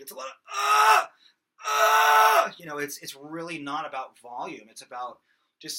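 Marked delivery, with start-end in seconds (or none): 0.5-2.5 loud, high gritty grunt; fry scream grunt; glottal fry grunt. Shown as a demonstration. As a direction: compress the glottis and push it out. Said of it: "is the glottal compression".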